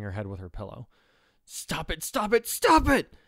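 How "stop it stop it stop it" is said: slow scream climbing high and loud; desperate scream. Acted. Girl screaming at her own thoughts, desperate and loud. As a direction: build from tense start to full scream. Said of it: "she screamed internally".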